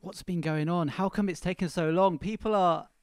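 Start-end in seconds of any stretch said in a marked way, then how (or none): none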